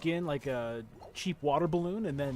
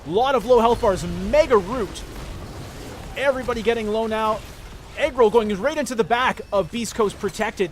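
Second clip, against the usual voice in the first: excited play-by-play; 3 semitones higher, 7 dB louder than usual. Acted. Hype play-by-play of teamfight.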